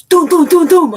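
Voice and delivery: high, loud imitated shots; loud mock gunfire from a car window; gunshot noises. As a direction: mimic the gun going off. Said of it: "reaches his hand out the window and goes".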